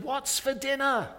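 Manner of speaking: high call from the next room; husband calling his wife from the hall, high-pitched; shouted question. Retold or imitated. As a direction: call out to the kitchen. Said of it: "and shouted".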